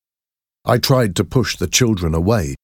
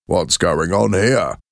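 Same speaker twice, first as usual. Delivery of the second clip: slow, stern officer; a hint of authority cutting in; policeman's call. A narrator's reading. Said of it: "he called".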